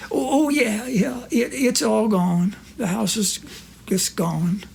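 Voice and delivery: Corky's voice. Retold or imitated. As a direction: play the innocent old pal.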